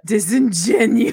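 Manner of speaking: slow, loud word swelling toward a mock sneeze; building to a sneeze. Friend saying the word that might make her sneeze, mock pre-sneeze. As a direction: draw it out loudly as if a sneeze is coming. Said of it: "It's almost here".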